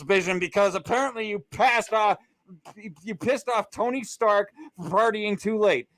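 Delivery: high-pitched, exasperated tirade; off-the-rails rant. Real, not acted. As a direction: rant at a sustained high pitch, outraged at the ending. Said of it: "that went a little off the rails".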